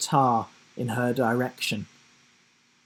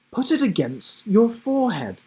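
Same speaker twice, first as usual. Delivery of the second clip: lightly voiced higher boy; recalled advice; 8 semitones higher than usual. A narrator's reading. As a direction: remember it as a sudden idea. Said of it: "he thought".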